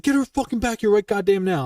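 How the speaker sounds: an irate sergeant's command; angry order. Retold or imitated. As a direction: bark the order in fury. Ordering the soldier back.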